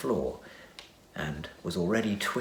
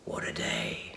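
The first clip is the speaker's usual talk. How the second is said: weary whisper; 10 semitones lower, 7 dB quieter than usual. Acted. Weary remark after the fight.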